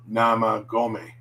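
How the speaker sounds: nama gome said slowly for repeating; slow model nama gome. Shown as a demonstration. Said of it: "You can repeat after me".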